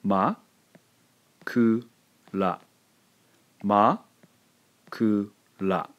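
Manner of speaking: "Ma, ku, ra" with a pause between ma and ku; with a silent beat. Shown as a demonstration. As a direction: hold a silent beat after "Ma". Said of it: "Let me pronounce it for you".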